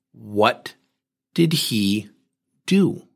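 "What", "did he", "do", each set apart by pauses; slow "What... did he... do?" with "do" lifted at the end; word-by-word question. Retold or imitated. Pressing her for facts, "What... did he... do?" word by word.

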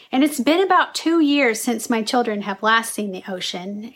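usual speaking voice

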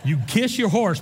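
normal talking voice

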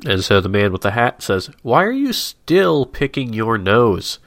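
normal talking voice

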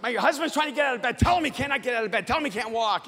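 imitated frantic wife. Wife telling nurse on her stubborn husband, imitated frantic rush. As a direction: rattle it off in a mock panic.

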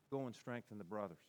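very soft, low charge; hushed commission. Acted. Jesus sends restored Peter to the brothers, hushed and low. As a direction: drop right down and send him gently.

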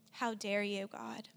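softly accusing, fading to a whisper; hushed reproach. Retold or imitated. Thinking it at God in the car, hushed reproach. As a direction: reproach God under the breath. Retold bitter thought aimed at God.

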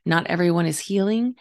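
usual speaking voice

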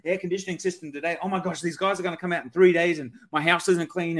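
acted fretting, quick and spiralling; hurried, worried second-guessing, hammed up a little; mock buyer's remorse. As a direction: play a customer talking themselves into a panic. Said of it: "been left in a negative feeling".

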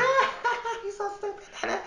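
high-pitched giggling put-down, quiet; a hint of mocking laughter, voice pushed up high; laughing mockery. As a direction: laugh through the insult like the roommate did. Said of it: "he came to the kitchen laughing".

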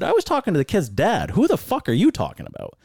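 high, argumentative comeback, retold; indignant retort. Racer retelling his reply to the spotter, indignant retort.

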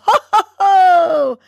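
high, loud, slow ho ho ho into real laughter; laughing ho ho ho. Shown as a demonstration. Host laughing along with listeners, slow loud ho ho ho. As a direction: stretch each ho into a laugh. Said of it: "Hands up".

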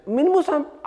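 high, stunned cry of disbelief; a mother who refuses to believe it; disbelieving mother. Retold or imitated. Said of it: "benægter umuligt".

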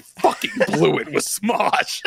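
mock-agonized lament over blowing it; drawn-out comic despair; self-reproach. Retelling his drunken, sleepless despair.

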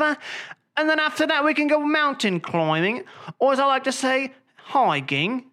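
high, jolly mock British accent; hammed-up British adventurer accent. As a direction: put on a plummy, excited British accent. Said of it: "what would they call that in Britain".

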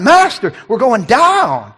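high, loud cry to the master, then drawn out; acted alarm, stretching the last words; hammed-up frightened wail; panicked cry. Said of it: "We find out they're timid".

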